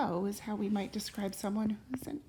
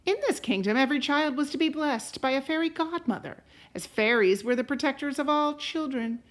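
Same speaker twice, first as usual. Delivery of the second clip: unhurried read-aloud voice, rising on the opening lines; storybook narration; 7 dB louder than usual. A narrator's reading. Parent reading a fairy tale to a child, gentle storytelling. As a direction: tell it like a bedtime story to a child. Reads a picture book aloud.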